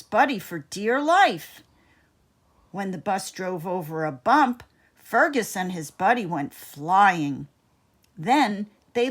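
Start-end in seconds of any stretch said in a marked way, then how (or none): none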